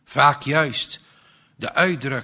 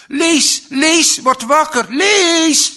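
high, loud desperate screaming of his name; shrieking; 14 semitones higher, 11 dB louder, slower than usual. A narrator's reading. Mother shrieks at her unconscious son to wake up, screaming. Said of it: "en krijste".

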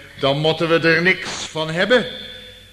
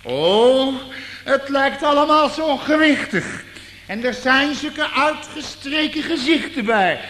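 high, loud mocking tone with a laugh; 10 semitones higher, 4 dB louder than usual. Real, not acted. Preacher mocking pious churchgoers, high and scornful. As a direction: ridicule the solemn faces.

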